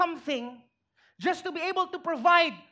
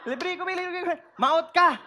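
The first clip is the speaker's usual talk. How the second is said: laughing while calling names; laughing teasing; 3 semitones higher, 3 dB louder than usual. Real, not acted. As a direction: tease a stingy friend through laughter. Joking about stingy friends.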